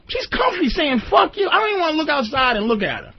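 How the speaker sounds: high-pitched, incredulous exasperation; exasperated. Real, not acted. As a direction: rant in exasperation, voice climbing way up high. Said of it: "the exasperation in my voice".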